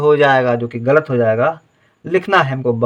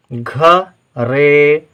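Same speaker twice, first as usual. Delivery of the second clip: usual speaking voice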